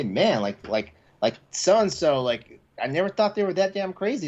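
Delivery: ordinary talk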